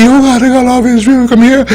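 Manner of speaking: high, fast mock gushing; mocking sing-song. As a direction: gush it out like a fake convert. Mocking Curacao's sudden change.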